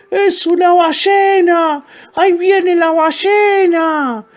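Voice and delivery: very high, loud, drawn-out shrieks; panicked screaming. A narrator's reading. Tiny crocodiles crying out mad with fear, panicked screaming. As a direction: scream like terrified little ones, stretching the words. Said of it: "comenzaron a gritar como locos de miedo".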